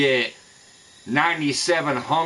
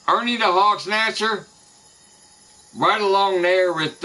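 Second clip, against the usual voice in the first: ordinary talk, nothing marked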